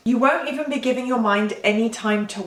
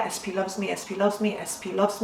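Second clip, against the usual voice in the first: soft, fast, monotone loop; mechanical, softer, under the breath; robotic repetition; 6 dB quieter than usual. Shown as a demonstration. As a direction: mutter it on repeat while busy. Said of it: "looping it robotically".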